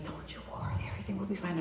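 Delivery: hushed, soothing near-whisper; soft reassurance. Retold or imitated. Lady beside her calms her during landing, hushed and reassuring. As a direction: softly soothe a frightened seatmate.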